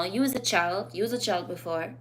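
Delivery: ordinary delivery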